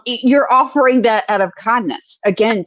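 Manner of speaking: usual speaking voice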